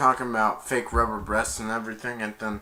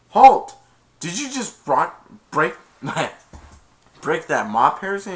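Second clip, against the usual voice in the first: stern principal; 4 semitones higher, 7 dB louder than usual. Retold or imitated. Principal catching Harrison breaking the mop, loud and stern. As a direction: bark it like an authority figure.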